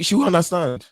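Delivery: put-on high African-accented assurance. Suitor dismissing his wife.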